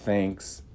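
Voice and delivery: "Thanks" soft with unvoiced th. Shown as a demonstration. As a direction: blow th gently in "Thanks". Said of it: "vai sempre usar esse T H".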